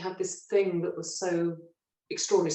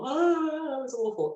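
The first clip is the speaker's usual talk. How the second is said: tearful; 7 semitones higher, slower than usual. Retold or imitated. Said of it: "went and phoned my mum".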